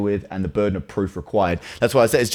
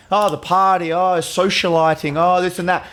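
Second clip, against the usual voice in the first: mock party gushing; 7 semitones higher than usual. Mocking actors' party talk.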